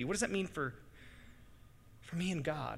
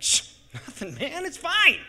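laughing, then higher and louder; laughing shush; 10 semitones higher, 12 dB louder, slower than usual. Retold or imitated. Dad to his kids, laughing it off. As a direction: brush it off with a laugh. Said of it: "I'm like".

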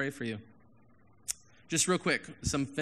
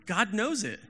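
builds higher and louder toward be real; rising insistence; 6 semitones higher, 3 dB louder than usual. Retold or imitated. Steele urging the pastor to be real with God, rising insistence. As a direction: coach them to be honest, climbing. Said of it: "he's like".

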